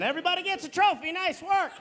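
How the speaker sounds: high, cheery pat-on-the-head voice; singsong fake encouragement; mock coach cheer. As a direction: hand out praise like a peewee coach. Mock coach praising kids.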